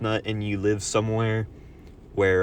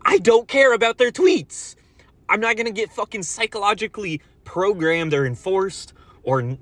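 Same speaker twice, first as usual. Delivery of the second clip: shouted, much higher and louder, furious; furious ranting shout; angry rant; 10 semitones higher, 4 dB louder than usual. Real, not acted. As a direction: blow up at the app makers. Ranting about the app changes.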